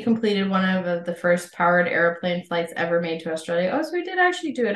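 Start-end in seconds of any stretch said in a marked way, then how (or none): 3.7-4.8 surprised. Real, not acted. Surprised discovery in notes.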